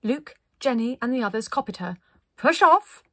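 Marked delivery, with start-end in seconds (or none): 2.4-3.0 high, loud call; cried out. A narrator's reading. Linda launching the group on the ice, crying out. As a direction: shout the order across the ice. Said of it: "cried Linda".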